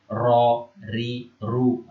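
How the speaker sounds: ro, ri, ru sounded out as separate syllables; contoh pelafalan huruf. Shown as a demonstration. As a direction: demonstrate ro, ri, ru one at a time. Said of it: "agak sedikit beda cara mengucapkan". (mencontohkan bunyi ro, ri, ru satu per satu)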